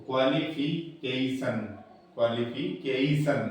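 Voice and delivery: Qualification split, with a long "KEI"; measured teaching qualification, weight before the final "san"; strong syllable. Shown as a demonstration. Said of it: "वो strong हो जाती है".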